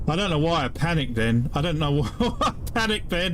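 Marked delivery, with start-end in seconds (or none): 1.5-3.4 laughing at himself. Real, not acted. Player laughing at his own panicked rescue, amused laugh mid-line. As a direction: laugh breaking through mid-sentence, pitched up.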